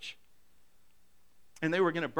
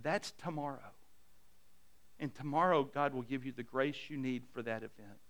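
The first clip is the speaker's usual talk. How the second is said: put-on tender old saint; 5 semitones lower, 8 dB quieter than usual. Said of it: "He says".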